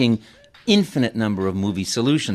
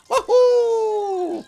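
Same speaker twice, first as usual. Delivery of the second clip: high, bouncy Italian plumber; big cheerful Mario, way up high; slower than usual. Acted. Voice actor greeting the host as Mario. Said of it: "Charles Martinet is here".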